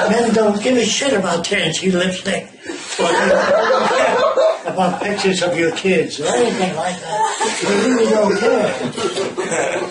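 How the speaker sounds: words pushed out through real laughter, high; laughing while talking. Real, not acted. Lecturer laughing as he mocks what men ignore. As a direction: chuckle through it, drawing it out.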